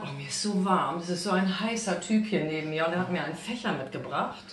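ordinary talk

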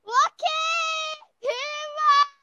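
slow high triumphant shout; drawn-out cheer. Real, not acted. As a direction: stretch it out, cheering. Victory cheer in game.

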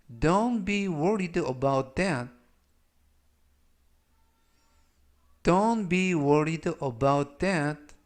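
Don't be worried about that, word by word, very slowly; very slow model reading. Shown as a demonstration. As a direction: read it out very slowly for students to copy. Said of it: "역시 천천히 세 번씩 읽어드리고요".

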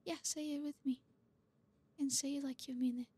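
put-on hushed, tender comforting boyfriend. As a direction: murmur close as if holding a crying partner. Tender whispered comfort roleplay.

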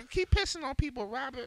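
lightly voiced scolding grandma. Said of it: "Fuck her old ass too".

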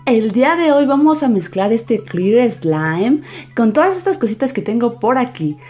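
put-on high, loud kid-show welcome; cheerful children's host greeting; bright squeaky sing-song welcome. Kids channel intro greeting.

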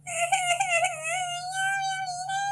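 high, long drawn-out wail; child crying after a fall. Acted. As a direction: wail like a hurt little girl. Fallen child wails.

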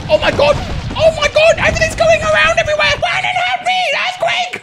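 high frantic shouting; panicked screaming. Real, not acted. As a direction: scream as everything flies around. Earthquake chaos in game.

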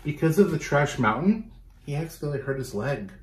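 ordinary delivery

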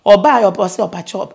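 usual speaking voice

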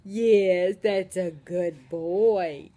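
low, slow tender praise. Real, not acted. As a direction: soothe him with praise. Praising baby.

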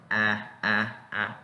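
"A" as in accurate, mouth opened; separate, wide-open "A" sounds. Shown as a demonstration. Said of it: "la boca se abre de esta forma".

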